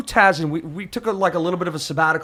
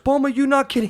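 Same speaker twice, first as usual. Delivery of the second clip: a lightly worried broken English; 9 semitones higher than usual. Retold or imitated. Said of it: "he broke in English".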